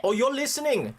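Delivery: high, lilting Welsh accent. Shown as a demonstration. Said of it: "you can do the Welsh accent".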